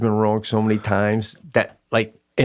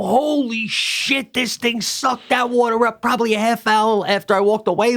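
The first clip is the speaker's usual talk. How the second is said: high-pitched, exclaimed disbelief; retold amazement, voice jumping way up; astonished outburst; 9 semitones higher than usual. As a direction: cry out in shock, stretching the key words. Retold shock at empty tree stand.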